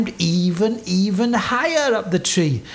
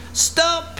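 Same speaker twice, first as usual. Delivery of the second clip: sudden high-pitched command; ordering; 13 semitones higher than usual. A narrator's reading. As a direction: call a halt in a high voice. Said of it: "the captain ordered".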